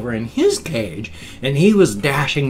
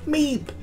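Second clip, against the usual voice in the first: tiny, very high squeaky meep; sharp high mock squeak; hamster meep imitation. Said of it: "looking up and going".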